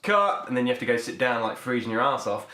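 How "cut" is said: film director. Retold or imitated. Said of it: "and then, like".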